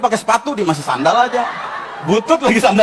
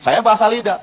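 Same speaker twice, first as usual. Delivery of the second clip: ordinary talk, nothing marked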